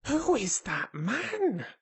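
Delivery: high, drawn-out alarmed question; hushed alarm pitched right up; loud alarmed whisper. A narrator's reading. Colin spots a stranger, alarmed whisper. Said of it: "suddenly alarmed whisper".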